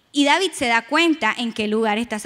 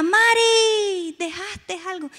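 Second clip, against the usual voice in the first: mother calling out; 7 semitones higher, much slower than usual. Retold or imitated. Mother calls her daughter.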